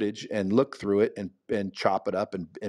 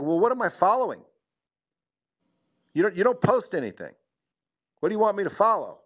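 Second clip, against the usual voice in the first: pitched well up, exasperated disbelief; mock incredulous; 8 semitones higher, faster than usual. To people asking for follows, mock incredulous disbelief. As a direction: act baffled by two posts, voice climbing.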